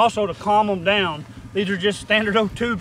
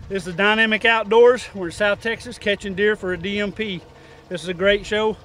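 announcer; 3 semitones higher, 4 dB louder than usual. Acted. Show outro to camera.

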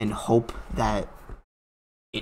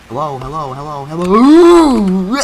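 repeated word building into a scream; escalating panicked greeting; rising alarm; 7 semitones higher, 13 dB louder than usual. Real, not acted. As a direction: start near normal, climb loud and high. Alarm building as enemy nears.

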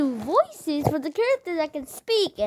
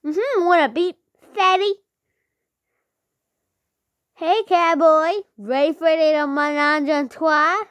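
higher, louder vampy come-on; flirty villainess; 5 semitones higher, 5 dB louder, slower than usual. Acted. YouTuber voices a flirty villainess, higher and louder. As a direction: purr it like a teasing seductress.

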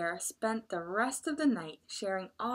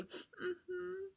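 tearful; 7 semitones higher, 6 dB quieter than usual. Acted. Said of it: "Tiger nodded tearfully".

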